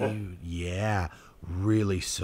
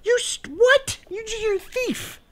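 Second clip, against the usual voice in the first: shrieking much higher and louder in mock shock; mock outrage; 8 dB louder than usual. Real, not acted. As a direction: squeal in playful disbelief at the thieving dog. Dog steals toy, mock outrage.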